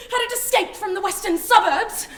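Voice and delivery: loud, high, half-laughing panic; hysterical disbelief. Acted. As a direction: lose it over a single bug. Panic over the cockroach.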